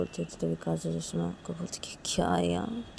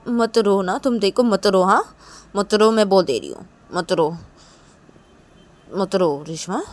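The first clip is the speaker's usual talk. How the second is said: Kajal's stern warning; 11 dB louder than usual. Acted. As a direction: warn her firmly, temper held in. Acts Kajal warning Reshma not to cry.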